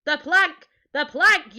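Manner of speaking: loud, high chanting yell; pirate yell. A narrator's reading. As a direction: yell it like a baying crew. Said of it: "yelled Ben Buckle and Percy Patch".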